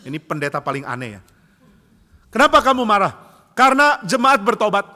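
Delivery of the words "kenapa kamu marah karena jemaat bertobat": loud, high acted outburst; dramatized angry preaching voice; heated exchange. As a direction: shout it in mock outrage.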